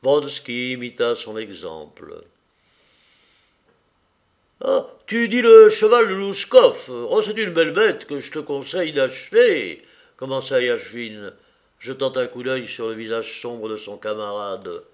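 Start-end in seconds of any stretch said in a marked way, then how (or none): 4.6-9.8 high, loud, hearty admiration; enthusiastic. A narrator's reading. Yachvin advising his friend on a horse, enthusiastic. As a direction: rave about a fine horse.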